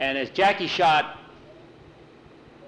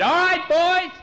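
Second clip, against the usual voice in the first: high, loud rallying shout; excited, drawn-out yell, pitched way up; calling out; 6 dB louder, slower than usual. A narrator's reading. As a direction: holler to teammates down the court. Player calls out to teammates.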